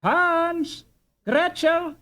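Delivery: put-on a mother calling her children in from the canal. A narrator's reading. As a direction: call the children home. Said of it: "quick voice was heard calling".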